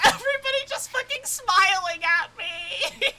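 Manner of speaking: laughing and yelling at once; laughing shout. Real, not acted. Gamer overwhelmed by smiling characters, laughing shout. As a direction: cry out through laughter.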